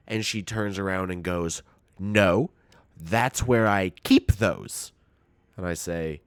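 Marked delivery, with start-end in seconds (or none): 2.0-4.9 slow, louder grandma insisting; firm old-lady insistence, slowed down; insistent grandma. Retold or imitated.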